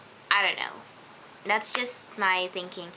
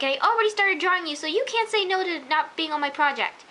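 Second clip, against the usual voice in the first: higher and louder, bubbling rush; excited Allie; 7 semitones higher, 5 dB louder than usual. Retold or imitated. Allie ranting her love to Leo, higher and excited. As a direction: quote her like a giddy girlfriend. Quoting Allie's cute rant.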